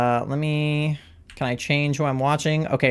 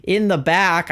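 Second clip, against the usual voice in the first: high, bright race-caller delivery; announcer voice; 5 semitones higher than usual. Pretend. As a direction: call the race like a sportscaster. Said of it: "let me do my announcer voice".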